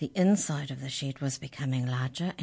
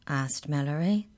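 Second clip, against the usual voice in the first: usual speaking voice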